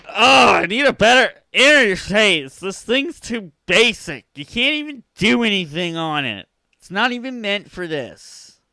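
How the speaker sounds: high, loud whining complaint; high loud griping; mock whiny rant. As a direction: whine like a spoiled kid.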